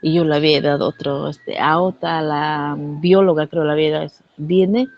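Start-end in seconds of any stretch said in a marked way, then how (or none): none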